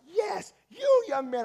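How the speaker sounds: loud, high pointed call; single him out from the pulpit; preacher calling out. Acted. Preacher singles out the young man, loud and high.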